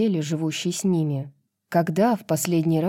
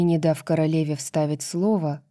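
usual speaking voice

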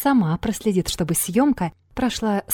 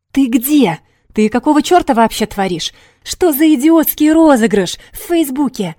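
loud, rapid furious outburst; rattling off angrily; 6 semitones higher, 9 dB louder than usual. A narrator's reading. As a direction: fire the questions off in a loud rush. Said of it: "Затараторил он".

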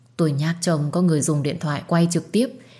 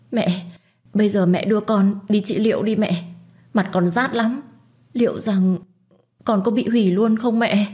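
pleading confession; 5 semitones higher than usual. Acted. Hương confessing to her mother, frightened pleading. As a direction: beg mother for help through fear.

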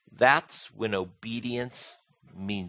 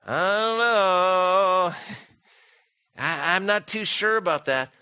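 reluctant doubt; 7 semitones higher, 4 dB louder, slower than usual. Retold or imitated. Believer hesitating at God's call.

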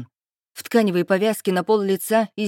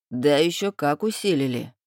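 lightly voiced thug, pitched lower; a hint of a lower thug voice; 4 semitones lower than usual. A narrator's reading. Thug answers the captive heroine.

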